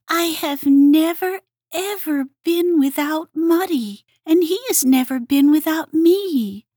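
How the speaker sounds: insist, heart on sleeve; earnest Hedgehog. A narrator's reading. Hedgehog's character voice.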